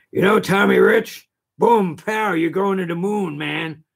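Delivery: Mister Wrestling Two as Kramden. Retold or imitated. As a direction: ham it up like a wrestling promo. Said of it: "sounds just like Ralph Cramden".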